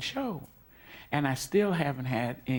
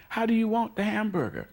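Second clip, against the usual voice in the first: Sullivan on the phone; 9 semitones higher than usual. Retold or imitated. Quoting Sullivan ordering food.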